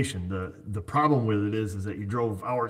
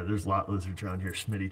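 ordinary talk

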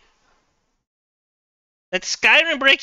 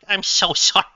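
normal talking voice

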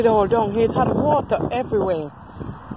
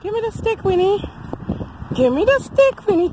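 high demand to a dog; high-pitched doggy talk; playful pet coaxing; 11 semitones higher than usual. Real, not acted. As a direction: coax the dog, high and teasing. Coaxing dog to drop stick.

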